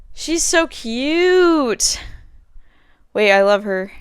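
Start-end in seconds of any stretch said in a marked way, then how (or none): 0.1-2.0 cooing. Real, not acted. Gushing over a friend's text.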